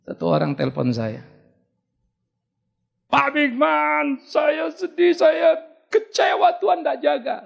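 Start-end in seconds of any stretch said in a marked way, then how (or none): 3.1-7.4 high, slow, drawn-out wail; mock distraught caller. Distraught caller pours out her grief to the pastor, hammed-up wail. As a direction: imitate a woman wailing her disappointment. Acts out distraught woman phoning him.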